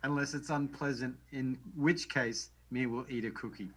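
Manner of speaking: Cookie Monster quote in Australian accent. Shown as a demonstration. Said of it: "I'm just gonna read it in, uh, Australian accent".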